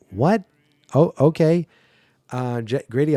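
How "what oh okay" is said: high, loud startled what, then a forced okay; mock shocked. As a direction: pretend to be caught off guard, then play along. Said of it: "I'd be like".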